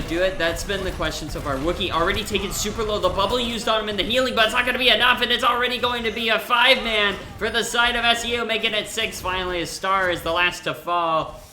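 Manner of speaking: loud, high, rapid excited commentary; live esports play-by-play. Real, not acted. Caster calling a team fight, urgent excited shout. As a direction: race through the fight at full volume.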